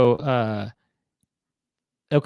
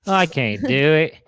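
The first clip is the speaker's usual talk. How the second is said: put-on Australian accent. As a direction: mimic it with broad Australian vowels. Mimicking in mock Australian.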